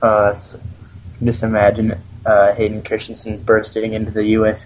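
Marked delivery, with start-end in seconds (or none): none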